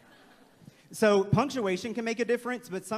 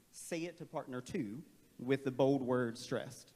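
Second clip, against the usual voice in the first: normal talking voice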